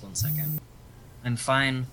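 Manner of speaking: ordinary talk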